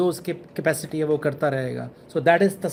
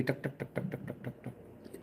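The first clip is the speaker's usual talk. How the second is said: bead clicks; 6 semitones lower, 20 dB quieter, slower than usual. Retold or imitated. Mimics prayer beads clicking mindlessly.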